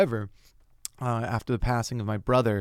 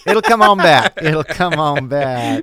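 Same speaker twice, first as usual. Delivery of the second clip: mock Southern drawl, loud and high; Southern accent; 4 semitones higher, 13 dB louder, slower than usual. Kidding the co-host about a dropped accent, hammed-up Southern drawl. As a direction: lay the Southern twang on thick, stretched out. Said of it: "we dropped the Southern accent".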